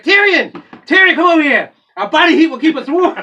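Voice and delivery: high, hammed-up shout for Tyrion; loud, high mock yell across a distance; imitated woman calling out. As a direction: holler it like the woman in the sketch. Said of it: "She's like".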